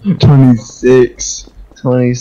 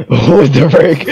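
laughing; 3 semitones higher, slower than usual. Real, not acted.

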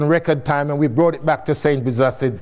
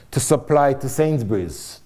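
ordinary delivery

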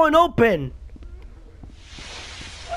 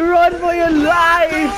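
slow urgent shout; 6 semitones higher, slower than usual. Real, not acted. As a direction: yell at everyone to flee. Fleeing monster in game.

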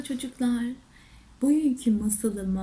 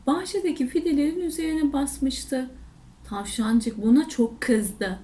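ordinary delivery

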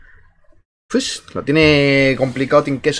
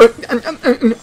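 glitching caster impression; 9 semitones higher than usual. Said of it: "le dio un spam y dijo".

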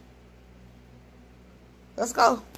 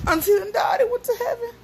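mock wail; 13 semitones higher than usual. Toddler's game, crying shout.

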